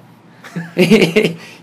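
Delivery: loud, high burst of laughter; real high cackling laugh; incredulous laugh. Real, not acted. Laughs at the retold refusal.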